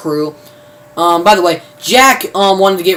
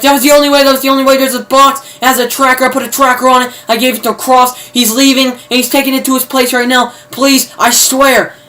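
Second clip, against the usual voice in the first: high, louder mock-desperate confession; panicked pleading; 7 semitones higher, 4 dB louder, faster than usual. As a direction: imitate a cornered man begging to be believed. Imitating man pleading under interrogation.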